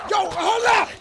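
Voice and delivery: high, very loud startled yell; panicked shout. Real, not acted. Gamer yelling at an attacker to hold up, panicked shout. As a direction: shout out in alarm.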